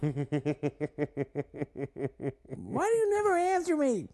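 very high cartoon complaint; Toad. Co-host in character to an unanswering partner, high squeaky voice. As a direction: plead in a squeaky falsetto. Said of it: "I like that Toad".

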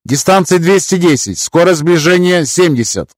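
high crisp operator voice; combat callout. Acted. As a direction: ship operator reporting. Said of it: "доложил оператор Грэб".

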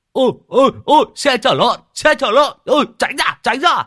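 high, loud yelps to clear the way; shouted warning. Acted. Rider warns friends of the pig cart, shouting in alarm. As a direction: yell out as the cart bears down.

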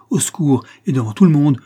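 loud, high threat; sudden energy. A narrator's reading. Old servant threatening to call for help, forceful. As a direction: threaten to raise the house, loudly. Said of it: "avec une énergie subite".

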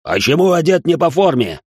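loud, high scolding bark; drill sergeant. A narrator's reading. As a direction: dress down the private. Sergeant berating private.

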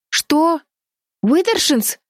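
high-pitched, astonished question; startled cry. A narrator's reading. Сорша calls up from below at the family name, startled cry. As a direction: call up in disbelief. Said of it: "крикнула снизу Сорша".

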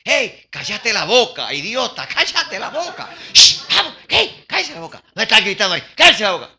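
high, exasperated shushing; hammed-up telling-off, pitch jumping up; scolding to shut up. Bystanders silence the shouting blind man, scolding. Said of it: "Lo regañaban".

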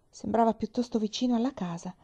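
ordinary delivery, nothing marked

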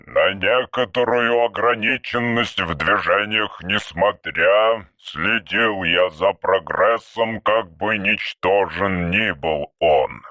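low, very slow archaic voice; an old machine speaking in verse; ancient robot. Acted. (низкий медленный голос древнего робота)

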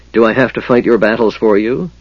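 usual speaking voice

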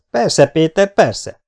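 loud, high-pitched call back; dazed shout. Acted. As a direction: shout out the answer, still stunned. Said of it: "kiáltott ki még mindig kábán". (kábán kikiáltva)

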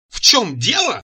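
high, indignant outcry; wailing yell. A narrator's reading. Цира outraged by his question, wailing anew. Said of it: "завопила она с новой силой".

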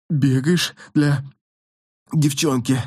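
slow, out of breath after running. A narrator's reading. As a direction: squeeze it out between breaths. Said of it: "с трудом дыша, выдавил Джонни".

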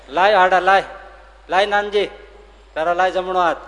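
loud, short bursts of command; commanding call. Acted. Maharaj tells Nanji to give his right hand, commanding loudly. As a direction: order him forward by name, forcefully.

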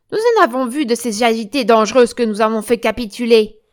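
forceful, dismissive loudness; loud boast. Acted. Catherine reassures her comrade about agitated patients, loudly boasting. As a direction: brush off the danger with a loud brag.

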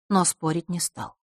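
usual speaking voice

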